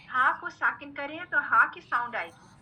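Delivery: normal talking voice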